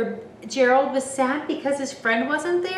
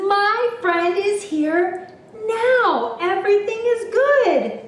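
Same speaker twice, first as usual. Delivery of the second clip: high, drawn-out happy exclamation; thrilled storybook character; overjoyed; 9 semitones higher, 5 dB louder than usual. A narrator's reading. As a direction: rejoice slowly, pitched way up. Gerald rejoices that friend is here.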